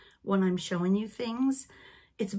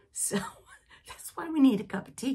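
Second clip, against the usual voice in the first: laughing; 5 semitones higher, faster than usual. Real, not acted. Laughing at her own tangents.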